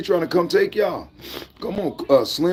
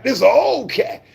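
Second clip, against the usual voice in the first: ordinary talk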